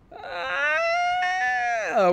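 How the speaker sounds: long, very high squealed "uh"; high-pitched whine. Real, not acted. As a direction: hold a thin high whine. High held noise while stuck.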